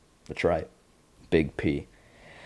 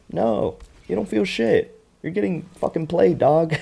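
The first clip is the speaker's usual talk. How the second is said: mocking; 11 semitones higher than usual. Real, not acted. Podcaster telling a duped character off, high and mocking.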